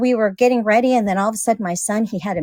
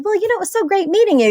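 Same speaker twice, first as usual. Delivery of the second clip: high, fast mock-formal goodbye to a stranger; acting polite host; 11 semitones higher, faster than usual. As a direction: play the gracious host who just met her. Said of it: "I was trying to act".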